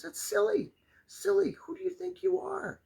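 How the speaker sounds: high, sing-song mock scold. Teasing viewers about pajamas in a high mock scold.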